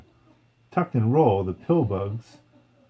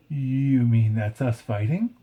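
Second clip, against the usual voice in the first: ordinary delivery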